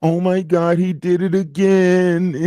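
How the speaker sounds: slow, faked whimpering voice; a hammed-up fake sob of outrage; mock crying woman. Streamer mocking a woman's fake tears on stream. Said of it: "So then she started crying".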